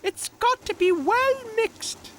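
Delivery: very high cartoon character voice; squeaky storybook know-it-all; Tech. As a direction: explain firmly in a piping little voice. Narrator voices Tech answering.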